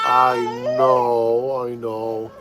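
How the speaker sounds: tender parent murmur; soothing. Real, not acted. Soothing his crying baby.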